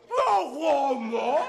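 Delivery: very slow laughing catchphrase; slow punchline. Acted. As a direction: drag it out smugly.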